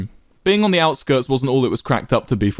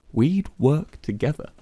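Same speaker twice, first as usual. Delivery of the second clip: slowly; 4 semitones higher, slower than usual. A narrator's reading. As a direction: let each word land slowly. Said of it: "Quackity spoke slowly".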